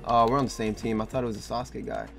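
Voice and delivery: usual speaking voice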